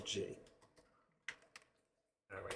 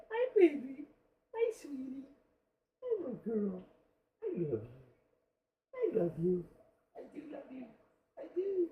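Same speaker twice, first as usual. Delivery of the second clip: high, tender pet-talk voice; small, high, affectionate coo; cooing to a cat; 13 semitones higher, 4 dB quieter than usual. Real, not acted.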